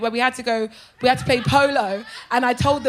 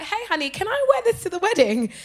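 cheeky sweetness; 7 semitones higher than usual. Retold or imitated. Acts her cheeky request to bride.

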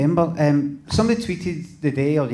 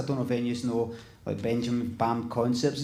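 ordinary delivery

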